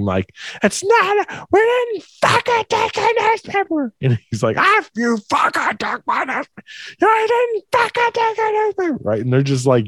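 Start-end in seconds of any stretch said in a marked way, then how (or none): 0.6-3.9 very high, frantic mock scream; imitated screaming back. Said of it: "stepmom stops flipping out back at him". 4.6-9.0 high-pitched mock shrieking; hammed-up back-and-forth screaming; imitated screaming match. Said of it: "she's literally screaming back and forth".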